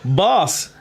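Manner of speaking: protesting shout. Acted. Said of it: "Gavin protested".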